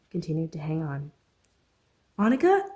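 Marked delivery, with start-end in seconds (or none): 2.2-2.7 high, loud fearful call; fear-stricken call. Acted. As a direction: call out afraid of what he'll find. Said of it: "A fear-stricken voice".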